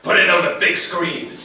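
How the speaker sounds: loud American general's bark; brusque, loud military command; barked order. A narrator's reading. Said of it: "barked the American general".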